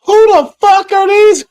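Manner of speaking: high, loud disbelieving shout; shocked outburst. Real, not acted. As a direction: blurt it out, stunned by the screen. Streamer shocked by on-screen teens.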